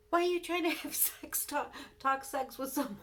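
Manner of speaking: high, loud mock husband voice; husband's indignant question. Wife imitating husband's jealous demand, high and loud.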